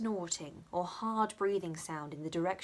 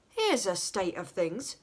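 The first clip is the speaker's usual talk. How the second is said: slow, louder, mock dismay; Dick exclaiming; 5 dB louder than usual. A narrator's reading. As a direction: declare it theatrically. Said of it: "cried Mister Swiveller".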